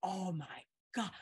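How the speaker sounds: soft, stunned murmur; quietly acted disbelief; mind blown. Classmate learning it was all a joke, stunned. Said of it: "My mind was blown completely".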